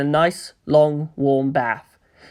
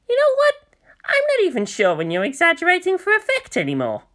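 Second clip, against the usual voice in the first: put-on much higher, exasperated boyish voice; half-teasing disbelief; Kensuke's voice; 12 semitones higher than usual. Kensuke's line in read dialogue.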